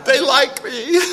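squealing up high in delight, laughing; a giddy high squeal of joy that breaks into laughter; giddy disbelief. Retold or imitated. Inner joy after first gig.

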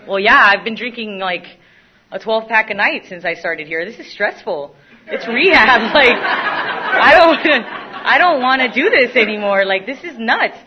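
loud, high mock rant with laughter; hammed-up rehab complaint; exasperated protest. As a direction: protest loudly, half laughing. Acting out her rehab protest.

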